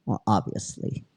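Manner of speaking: a dry whispered aside, breathless. Real, not acted. Storyteller whispers a dry aside to listeners. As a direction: drop to a whisper for the joke.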